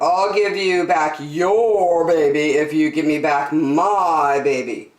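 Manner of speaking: emphatic, slowing on the final words; bargaining father. Acted. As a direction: drive a firm bargain, stretching the end.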